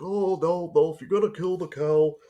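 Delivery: put-on high, loud old country bumpkin voice; Mortimer Snerd impression. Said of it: "how he delivered Mortimer S-, Snerd's lines".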